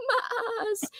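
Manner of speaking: put-on high, soft laughing Southern accent; lightly imitating a Southern accent. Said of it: "like, a Southern accent with it".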